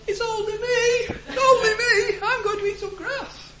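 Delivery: very high, laughing squeaky goat cry; squealing little goat. Retold or imitated. Preacher voicing the little goat, squealing high with laughter. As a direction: squeal it like a tiny scared billy goat. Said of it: "the little goat squeals".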